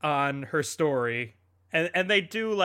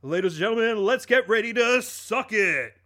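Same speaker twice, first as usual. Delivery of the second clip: ring announcer; 5 semitones higher, 4 dB louder than usual. Retold or imitated. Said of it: "He goes".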